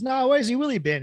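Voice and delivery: put-on joke-telling Aussie accent; Australian accent. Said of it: "got the Australian vernacular down perfectly".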